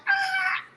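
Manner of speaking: high-pitched excited squeal. Retold or imitated. Hearing the captain is pregnant, excited squeal. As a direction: squeal with joy.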